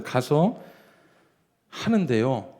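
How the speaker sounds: ordinary talk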